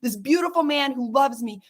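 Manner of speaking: usual speaking voice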